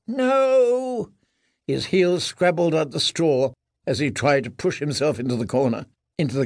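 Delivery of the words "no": high, thin pleading moan; frightened mumble.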